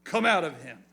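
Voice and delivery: commanding. Acted. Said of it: "And Jesus said".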